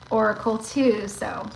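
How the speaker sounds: normal talking voice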